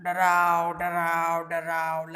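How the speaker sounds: low repeated "darao" call; animal call imitation. Shown as a demonstration. Teacher demonstrates the animal's call to the children, low. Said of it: "Like this, he's doing".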